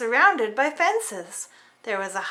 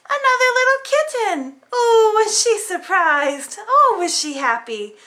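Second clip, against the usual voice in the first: much higher and louder, thrilled; delighted surprise; 7 semitones higher, 6 dB louder than usual. A narrator's reading. Storyteller revealing the new kitten friend, delighted. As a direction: burst out with joyful surprise.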